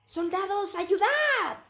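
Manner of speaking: grito agudo de mando; llama a los soldados a gritos; orden gritada. Acted. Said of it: "siguió dando órdenes". (Talik ordena a gritos a los soldados)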